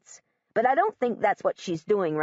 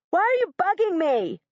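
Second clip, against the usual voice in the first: high, angry shout; boy shouts at the girl correcting him; 10 semitones higher, 3 dB louder than usual. Acted. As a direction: bark it at her in frustration. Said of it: "he shouts".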